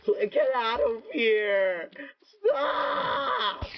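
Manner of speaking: high, strained yell at attackers; frantic high-pitched yelling; panicked yelling. Real, not acted. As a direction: shriek them away in panic. Attacked in game, yelling at mobs.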